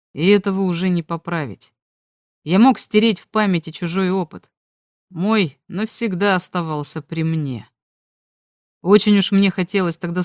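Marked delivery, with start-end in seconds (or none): none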